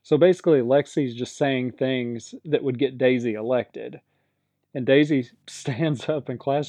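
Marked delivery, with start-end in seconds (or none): none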